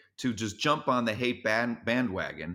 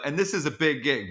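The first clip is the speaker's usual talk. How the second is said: usual speaking voice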